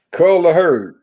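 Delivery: loud, high, falling exclamation; emphatic call. Real, not acted. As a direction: call it out like a punchline. Calls out the phrase emphatically.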